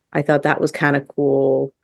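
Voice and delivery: ordinary delivery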